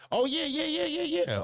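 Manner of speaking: light up at the name; excited recognition. Real, not acted.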